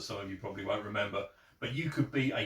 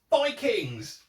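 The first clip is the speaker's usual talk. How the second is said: excited title call; 11 semitones higher, 8 dB louder than usual. Acted. Announcing a rejected concept.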